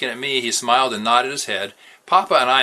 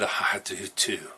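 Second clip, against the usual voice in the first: soft, slow, breathy hush; a hushed private wish; under his breath; 4 semitones lower, 9 dB quieter, much slower than usual. A narrator's reading. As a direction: say it barely aloud to yourself. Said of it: "Under my breath".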